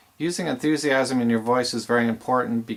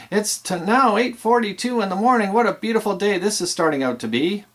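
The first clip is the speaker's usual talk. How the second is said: upbeat radio host voice, much higher; enthusiastic radio DJ; 5 semitones higher, 4 dB louder than usual. Shown as a demonstration. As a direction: sell the song and the morning like a morning-show host. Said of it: "So it needs to go something like this".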